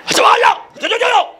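high, excited mock-Chinese babble; mock Chinese diner. Chinese diner delighted by new dish, high mock-Chinese babble. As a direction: yelp nonsense syllables high up.

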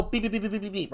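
high, soft, rapid repeated beeps; alarm beeping. Retold or imitated. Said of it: "anti-theft machines".

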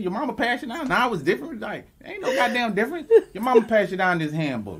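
high, laughing mock voice; laughing mock imitation. Shown as a demonstration. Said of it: "that's how you said it".

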